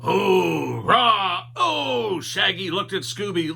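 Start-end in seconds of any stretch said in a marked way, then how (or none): 0.0-2.2 loud, odd monster groan; monster moan. A narrator's reading. The snow monster moaning at Shaggy, loud and strange.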